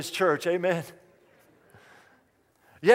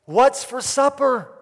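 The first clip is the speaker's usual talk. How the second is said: slow, raised voice to get heard; higher, louder and drawn out for a deaf listener; louder repeated question; 5 semitones higher, 6 dB louder, slower than usual. Retold or imitated. Husband right behind his wife, asking louder.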